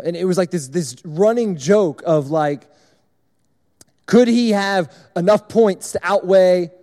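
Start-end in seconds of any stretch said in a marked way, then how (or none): none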